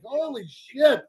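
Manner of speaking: high, put-on voice of mock shock; hammed-up startled gasp of words; shocked exclamation. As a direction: blurt it like the noise just hit you. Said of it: "I'm like".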